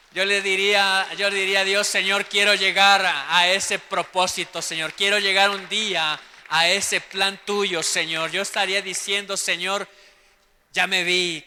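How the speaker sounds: loud fervent preaching of a prayer. Acted. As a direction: pour it out as a loud earnest plea. (predicación fervorosa)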